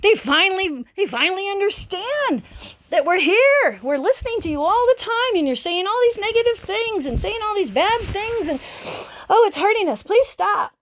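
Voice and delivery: the body's cells answering the owner, excited and pleading; excited body voicing. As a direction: play the body, thrilled to be heard at last, then begging. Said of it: "your body gets all excited and says".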